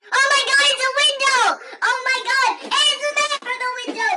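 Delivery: loud, high-pitched alarm; frantic, high dramatic shouting; hammed-up panic. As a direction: yell out the sighting in mock terror. Man from the window appears.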